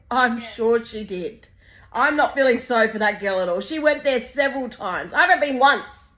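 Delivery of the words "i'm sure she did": sarcastic drawl. Acted.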